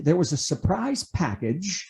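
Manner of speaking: ordinary delivery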